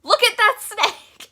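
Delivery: high, loud, drawn-out mom shriek; freaking out. Retold or imitated. Mom going on about the snake, freaking out. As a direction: imitate mom shrieking, with a laugh breaking in. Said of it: "she was freaking out".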